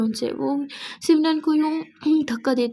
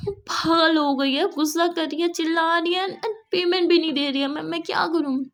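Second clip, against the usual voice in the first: flustered complaint; 4 semitones higher than usual. Acted. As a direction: pile up the complaints anxiously. Employee complaining about angry client.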